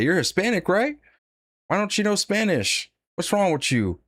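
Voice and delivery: high, pushy mock customer voice. Retold customer scolding a store clerk, high mock voice. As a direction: imitate the customers nagging him about Spanish. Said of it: "they look at me and they say".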